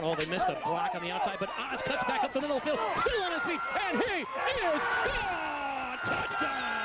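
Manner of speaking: rising, shouted touchdown call. Real, not acted. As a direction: build the call up to the score.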